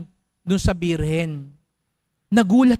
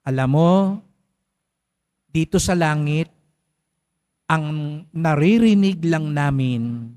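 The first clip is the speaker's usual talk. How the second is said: gentle wise explanation; 5 semitones lower, slower than usual. A narrator's reading. San Pedro explains gently.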